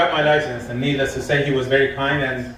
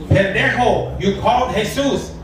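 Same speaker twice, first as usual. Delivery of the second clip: mock exasperated Spanish-accented scold, higher; 5 semitones higher than usual. Gardener scolding the confused caller in a mock Spanish accent.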